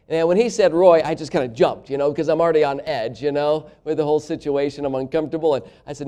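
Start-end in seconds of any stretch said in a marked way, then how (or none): 0.4-1.0 Roy said loud and high; blurted Roy. Retold or imitated. Preacher mimicking the stranger, Roy blurted loud. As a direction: pop the word Roy out loud. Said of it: "he literally blurted it out".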